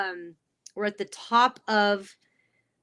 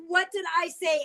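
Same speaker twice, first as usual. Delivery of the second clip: higher-pitched mock horror; horrified; 8 semitones higher than usual. Boss asks what he just said to the girls, mock horrified. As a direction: imitate someone aghast at their own words. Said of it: "he looked at me and horrified".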